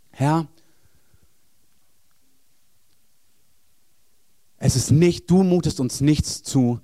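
loud, earnest prayer over the church; spoken prayer with rising intensity; fervent prayer. Acted. Preacher prays aloud over congregation.

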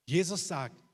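normal talking voice

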